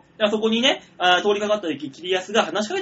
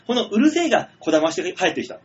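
ordinary delivery

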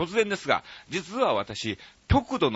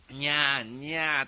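lightly imitated drawn-out meows; cat meow imitation; 3 semitones higher, slower than usual. Host acting out the listener's solo cat imitation, lightly imitated meows. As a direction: meow like a lone cat at night. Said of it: "猫の鳴き真似".

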